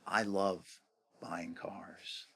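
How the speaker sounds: hushed, whispered confession; confiding whisper. Real, not acted. Confessing a love of car buying, confiding whisper. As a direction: drop suddenly to a whisper.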